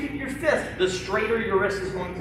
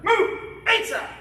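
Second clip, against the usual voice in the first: drill command shout; 12 semitones higher, slower than usual. Real, not acted. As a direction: snap the command out to the class. Instructor calling drill commands.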